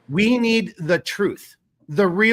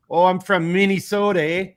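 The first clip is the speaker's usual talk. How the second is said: mock Minnesota accent, louder at the start; 5 dB louder than usual. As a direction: put on a hammed-up Minnesota accent with a tag "eh?". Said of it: "I had a Minnesota accent".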